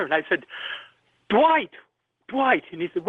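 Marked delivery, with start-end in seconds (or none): 1.3-2.6 anguished. Retold or imitated. Telling his younger brother who died, high anguished cry. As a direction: force the name out through grief.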